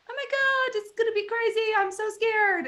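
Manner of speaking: high-pitched hammed-up scared self; mock panic. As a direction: play up your own past panic. Retelling own panic moment.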